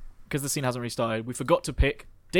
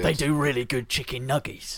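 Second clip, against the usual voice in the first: Jason Statham. Acted. Voices Jason Statham praising food.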